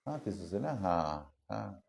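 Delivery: ordinary talk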